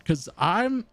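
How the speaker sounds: usual speaking voice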